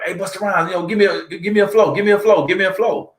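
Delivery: high-pitched mock pleading of an insistent fan; imitated begging. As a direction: pester a star, high and repetitive, imitating her. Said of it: "this lady was begging".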